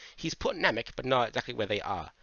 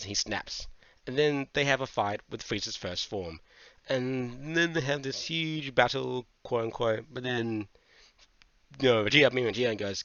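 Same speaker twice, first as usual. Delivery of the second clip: ordinary talk, nothing marked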